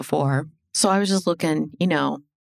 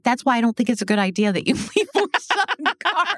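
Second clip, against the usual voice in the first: higher, fast, giggling through it; laughing friend; 8 semitones higher, faster than usual. Retold or imitated. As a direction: scold him while laughing. Friend scolding him, laughing.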